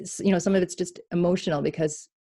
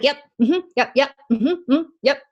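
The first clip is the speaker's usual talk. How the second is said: higher, louder brush-off yeps; dismissive yep; 8 semitones higher, 6 dB louder than usual. Retold or imitated. Inner child brushing off God, higher impatient yeps. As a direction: rattle off yeps to shut him down.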